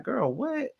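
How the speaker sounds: mock disbelief. Voicing her own retort to Kayla.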